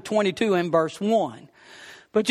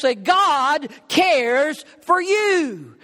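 each word of "God cares for you" drawn out slowly; the sermon's core line, slowed right down; emphatic slow message; 5 semitones higher, much slower than usual. Acted.

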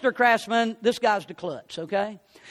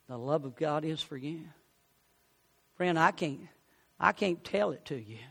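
soft invitation prayer; 7 semitones lower, 7 dB quieter than usual. Acted. Preacher inviting listeners to pray, softly. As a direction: draw them in gently.

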